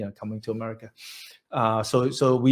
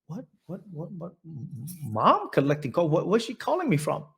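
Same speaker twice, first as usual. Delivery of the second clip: stunned disbelief; 4 semitones higher than usual. Retold or imitated. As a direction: repeat it haltingly, as if you can't believe who's calling. Stunned by mother's collect call.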